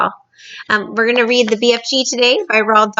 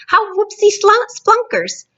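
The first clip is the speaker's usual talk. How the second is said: beaming cry; 13 semitones higher than usual. Acted. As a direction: squeal with giddy joy. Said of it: "cried the B F G, still beaming".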